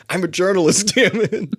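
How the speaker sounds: high, loud indignant actor; mock indignation. Playing the slighted actor with selfie stick, mock-outraged cry.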